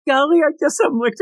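put-on high, fast girlish coo; coy golly. Retelling her line in a high, quick teasing coo. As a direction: coo it like a teasing cartoon vamp. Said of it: "She said".

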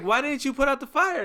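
high-pitched retold disbelief; incredulous. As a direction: ask it high, as if baffled. Retold incredulous question to mom.

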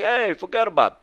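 high, loud mock New York tough-guy accent; mocking Chris. Coach teasing client Chris in a hammed-up New York accent. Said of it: "He's like".

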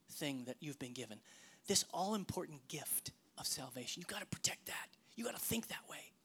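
breathy urgent whisper; intense whisper. Acted. Preacher urging the congregation in a whisper. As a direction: lean in and whisper it like a secret. Urging protection in hushed tones.

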